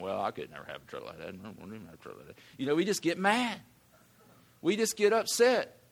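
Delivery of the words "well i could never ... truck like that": much lower, much quieter, fast sulky mutter; jealous grumble. Retold or imitated. Preacher mimics a jealous churchgoer grumbling about a truck, low and sulky. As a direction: grumble it under your breath, bitter and quick. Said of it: "We're going".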